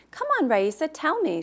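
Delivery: pleading. A narrator's reading. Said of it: "she pleaded".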